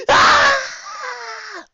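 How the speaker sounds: very high, loud shriek; high shrieking howl. Acted. Three friends caught together, howling scream. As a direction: howl in panic. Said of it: "All three howled".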